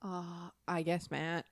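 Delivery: ordinary delivery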